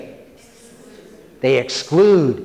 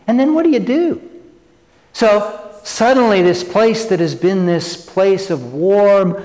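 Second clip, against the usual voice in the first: ordinary delivery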